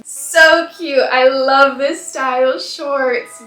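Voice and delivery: loud, drawn-out adoration; thrilled, emphatic squee; delighted gushing. Real, not acted. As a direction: gush loudly, stretching the words. Gushing over the shorts.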